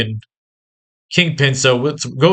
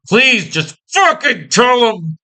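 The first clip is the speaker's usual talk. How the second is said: high, drawn-out desperate plea; frustrated begging; 8 semitones higher, slower than usual. Acted. Viewer begging the comic's hero to be told, frustrated plea. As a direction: beg slowly, pitched way up. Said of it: "you're begging as the fucking viewer".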